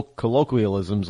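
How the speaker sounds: usual speaking voice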